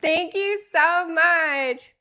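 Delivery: loud, very slow stretched thanks; slow drawn-out thanks. Real, not acted. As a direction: stretch every word out.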